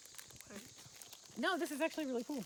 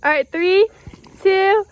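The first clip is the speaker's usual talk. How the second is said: shouted countdown, higher; loud countdown; 8 semitones higher, 20 dB louder, much slower than usual. Real, not acted. Another countdown to jump.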